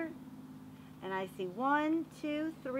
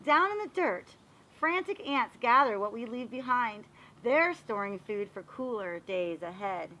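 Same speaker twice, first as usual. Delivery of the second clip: storybook narration; 6 dB louder than usual. Acted. Teacher reading a garden book aloud to the class, storybook voice. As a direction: read it like a picture book for little kids. Reads picture book to children.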